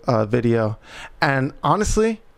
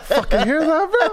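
high, breaking up with laughter; laughing proud boast; 13 semitones higher than usual. Retold or imitated. Son bragging to dad, imitated through laughter. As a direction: play a proud kid while cracking up.

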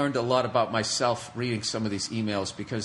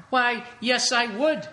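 much higher, prissy fake courtesy; sarcastically sweet reply to a machine; higher, mock-polite answer to the recorder's question; mock politeness; 10 semitones higher, slower than usual. Sarcastic answer to device prompt.

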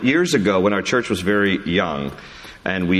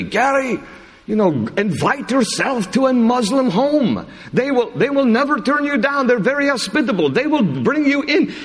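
much higher, lively imitation of a friend; imitating Daniel. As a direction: pitch it way up to mimic Daniel inviting you. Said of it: "he's the one that said to me".

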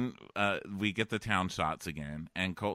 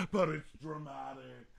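high, gasped melodrama; gasp, then overplay the drama; mock dramatic; 6 semitones higher, 3 dB quieter than usual. Acted audience lady overcome by drama.

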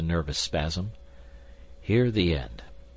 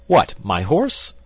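loud, high, drawn-out astonished question; shocked disbelief; 7 semitones higher, 10 dB louder, slower than usual. A narrator's reading. Young man learning his friend gambled away his horse, shocked. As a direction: burst out in disbelief.

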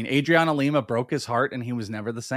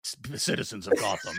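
whispered Batman imitation; 7 semitones lower, 9 dB quieter than usual. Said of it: "I'm like".

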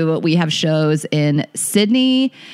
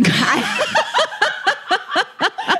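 high burst of laughter. Real, not acted. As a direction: laugh out at the joke. Laughing at flex joke.

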